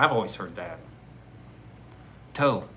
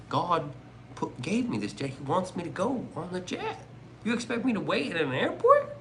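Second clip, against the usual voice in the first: mock-indignant preacher, pitch climbing high; hint of a preacher, rising to an incredulous high question; lightly voiced preacher; 7 semitones higher than usual. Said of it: "you remember the preacher".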